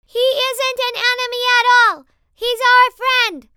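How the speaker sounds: very loud, high, held-pitch recording voice; canned, recorded-sounding. A narrator's reading. Possessed boy insists the enemy is a friend, canned. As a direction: proclaim it like a recording at full volume. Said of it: "There was a canned quality to it".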